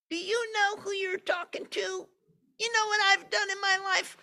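put-on very high, squeaky little-kid voice; tiny boastful child voice; conceited toddler. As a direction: play a bragging two-year-old. Conceited two-year-old voiced.

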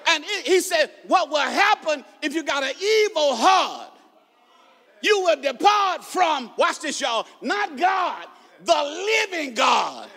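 high, driving pulpit shout; ringing sermon climax, phrase by phrase; full-force preaching. Real, not acted. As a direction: preach hard, stretching the key words.

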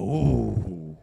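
low mock dog groan, ooh; low grumbling ooh like a dog; dog's low grumble. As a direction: imitate a grumbling dog holding back a bark. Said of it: "He'd go".

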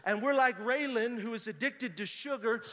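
preaching that builds to a high, loud climax; building preaching. Real, not acted. Preacher voicing God's promise to congregation, building preaching. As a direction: swell from firm exhortation to full-force proclamation.

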